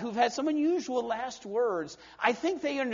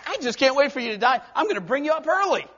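higher, fast, eager voice of God; God bursting with impatience; 5 semitones higher, 5 dB louder, faster than usual. Acted. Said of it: "God said".

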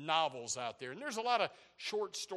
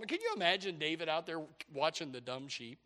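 usual speaking voice

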